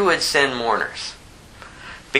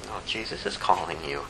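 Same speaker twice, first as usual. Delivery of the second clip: low, very soft insistence; hushed summons; 7 semitones lower, 10 dB quieter than usual. Acted. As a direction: gently call her out, low and soft. Martha's message to withdrawn sister.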